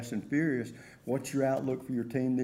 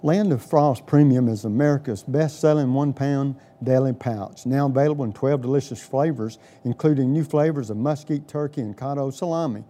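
upbeat, louder radio ad read; chipper 1950s ad; 3 semitones higher, 8 dB louder than usual. Acted. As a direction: sell it like a retro radio spot. Host reads sponsor ad.

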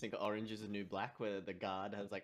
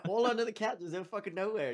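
exasperated; 10 semitones higher, 7 dB louder than usual. Real, not acted. Exasperated about the lost vape.

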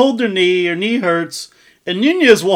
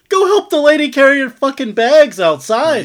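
raised, higher call across the room; 7 semitones higher, 4 dB louder than usual. Retold or imitated. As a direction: bark it at him like he's in earshot. Shouting at Usman on TV.

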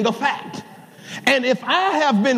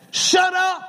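shut up; 7 semitones higher than usual. Acted. Preacher barking at congregation.

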